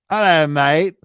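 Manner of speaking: playful put-on Australian greeting; Australian accent. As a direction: greet a mate in exaggerated Australian. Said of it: "There was an Australian guy".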